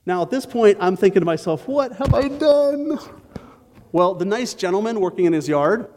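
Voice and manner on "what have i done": high, slow stunned inner cry; dismayed thought. Retold or imitated. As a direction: say it slowly, high, aghast at yourself. Thinking after branch crashes.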